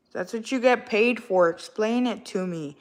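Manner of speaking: usual speaking voice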